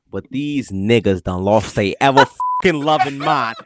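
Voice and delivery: ordinary talk, nothing marked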